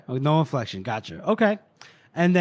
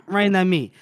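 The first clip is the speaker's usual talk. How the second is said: ordinary talk, nothing marked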